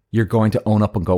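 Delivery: normal talking voice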